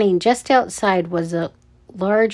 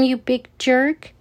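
mild, higher-pitched indignant yell; 5 semitones higher than usual. A narrator's reading. Ella fights off the guard, mild yell. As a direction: yell it as a young scout would, without full volume. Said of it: "Ella yelled".

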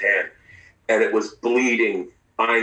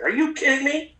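high incredulous doctor; imitating the doctor's disbelief; mock exasperated doctor; frustrated disbelief. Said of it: "he was frustrated".

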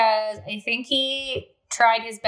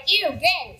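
mocking disgust; 7 semitones higher than usual. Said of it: "because they're like".

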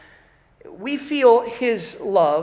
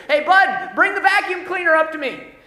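louder mom shouting downstairs; mom yelling; 7 semitones higher, 7 dB louder than usual. Retold or imitated. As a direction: call him from upstairs. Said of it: "Mom yells from upstairs".

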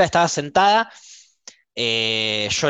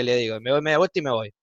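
normal talking voice

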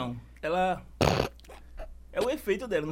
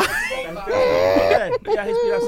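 shouting; 12 dB louder, much slower than usual. Real, not acted. Loud excited question mid-challenge.

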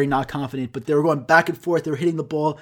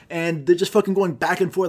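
usual speaking voice

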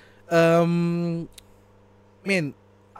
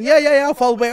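mock eager reply; 9 semitones higher, 7 dB louder than usual. Mock reply in quoted scene.

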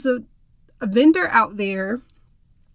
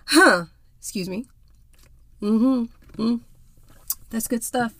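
while sipping a drink. Real, not acted. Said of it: "what you will hear me drinking".

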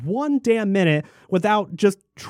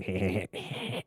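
low, soft dumb snicker; Beavis and Butthead snicker; 11 semitones lower, 14 dB quieter than usual. Retold or imitated. Mocking guys snickering to each other, low dumb snicker. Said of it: "Beavis and Butthead snicker".

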